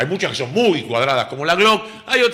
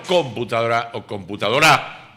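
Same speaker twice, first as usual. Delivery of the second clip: COMputadora, then computadoRÁ; computadora deliberately misstressed; 3 semitones lower than usual. Shown as a demonstration. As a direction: shift stress to first, then last syllable. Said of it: "como si fuera guaraní".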